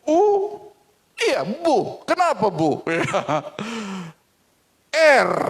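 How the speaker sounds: put-on high, sparring kids; cheeky sibling banter in high voices; child voices. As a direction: trade jabs like bickering siblings. Siblings spar over the bird.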